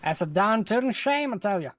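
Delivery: lightly put-on Southern accent. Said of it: "caps off the Southern accent display".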